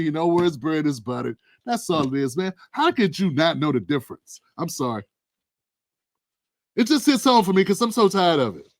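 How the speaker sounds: usual speaking voice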